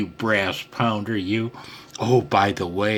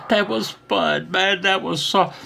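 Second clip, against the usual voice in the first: much higher, laughing, bursting with delight; giddy with joy at the win; thrilled; 11 semitones higher, 3 dB louder than usual. Real, not acted. Thrilled after his first contact with Fred, laughing high.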